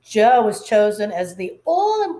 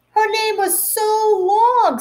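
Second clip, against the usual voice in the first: mocking child; 12 semitones higher, 4 dB louder than usual. Child mocking classmate's name.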